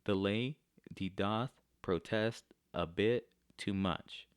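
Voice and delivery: "the lady doth protest a bit too much" broken into two-syllable beats; iambic stress. Shown as a demonstration. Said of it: "So we always go unstressed, stressed".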